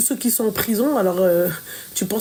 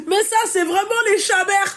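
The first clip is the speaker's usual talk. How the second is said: high, laughing delivery; amused and laughing; 10 semitones higher than usual. Real, not acted. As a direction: still giggling. Still laughing at the slip.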